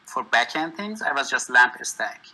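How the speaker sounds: usual speaking voice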